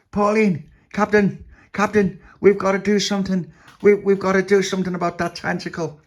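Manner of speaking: high, loud character plea; urgent pleading. A narrator's reading. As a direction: plead loudly with the captain to act. Tony pleads with captain.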